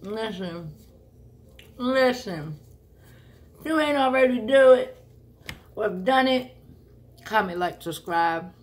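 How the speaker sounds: talking through a mouthful of food; words around a full mouth. Real, not acted. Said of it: "I'ma say this with a mouthful of food".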